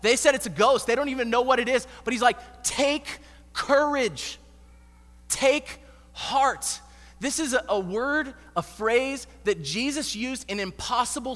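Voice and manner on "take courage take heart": slow, higher, stretched words; lifted, deliberate reassurance; drawn-out encouraging call. Acted. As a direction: call it slowly across the waves. Jesus calling to the disciples.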